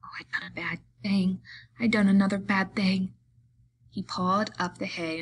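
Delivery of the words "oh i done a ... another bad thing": hushed, breathy fright; frightened stage whisper, firmer toward the end. A narrator's reading. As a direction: whisper it in dawning dread. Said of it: "then he whispered in fright".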